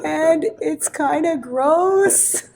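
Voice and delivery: put-on a crying kid answering reluctantly, slowly; tearful child. Crying student naming what she sees to teacher, slow tearful child. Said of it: "and she goes".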